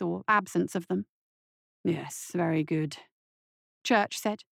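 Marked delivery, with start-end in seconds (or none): 1.8-3.0 low, slow, measured doctor; quietly approving. Acted. Doctor examining a patient's scars, quietly approving. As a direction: murmur it with calm satisfaction.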